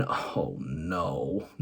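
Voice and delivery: deep, soft "Oh, no" groan; catchphrase groan, low and soft; low dismayed groan. Retold or imitated. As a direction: drop low and quiet in dismay. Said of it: "I'm just thinking".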